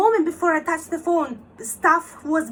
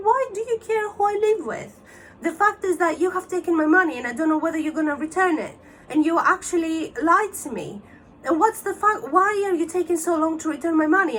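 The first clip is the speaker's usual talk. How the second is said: retold outburst, starting high-pitched and indignant; indignant confrontation; 3 semitones higher than usual. Caller confronting scammer over taken money, indignant. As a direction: confront him, pitch jumping up on the challenge.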